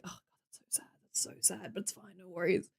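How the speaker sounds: very soft, whispered aside imitating a private reaction; hushed mock-pitying whisper; whispered dismay. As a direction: whisper it under your breath, as if privately appalled. Retells reaction to mum's life.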